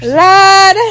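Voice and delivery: loud, high, drawn-out cry of Lord; calling on God. Real, not acted. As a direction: call out to heaven, loud and long. Calls out to God to begin praying.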